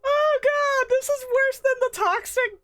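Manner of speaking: very high, loud wail of disbelief; real hysterical disbelief at full volume; overwhelmed shrieking. Real, not acted. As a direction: wail it, climbing into a squeal.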